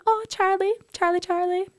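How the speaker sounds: put-on very high, cooing child voice; imitated little daughter. Mother imitating daughter naming baby brother, cooing child voice. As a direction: coo it like a tiny child. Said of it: "I was actually going".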